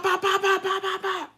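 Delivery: high, clipped pop pop gun sounds; mock gunshots. Threatening the bystanders, high mock gunfire. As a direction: fire off imaginary shots.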